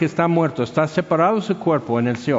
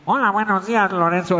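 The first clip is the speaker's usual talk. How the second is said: higher-pitched, stopped-up Mazatec accent; nasal Mazatec accent; 4 semitones higher than usual. Shown as a demonstration. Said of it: "la pronunciación es como si estuvieran tapados".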